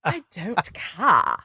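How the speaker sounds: slow, drawn-out catchphrase in a put-on accent; Clarice impression. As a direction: stretch it out in Clarice's affected accent. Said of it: "this is the saying that my sister and I".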